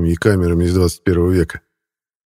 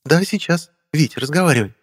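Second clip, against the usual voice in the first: высокий женский голос в диалоге; сообщи тонким, заметно поднятым голосом; Вера; 9 semitones higher than usual. A narrator's reading. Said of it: "Тоже в трубку". (женская реплика в высоком регистре)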